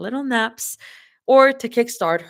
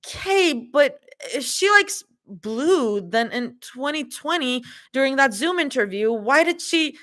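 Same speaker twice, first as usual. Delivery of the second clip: high voice, building to argue; Zoe voice; 7 semitones higher than usual. Acted. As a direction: pitch up and push the point.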